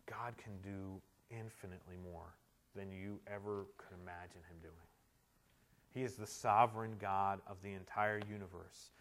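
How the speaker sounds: low, hushed, earnest appeal; solemn preaching. Real, not acted. Preacher closing the sermon to his friends, solemn and low.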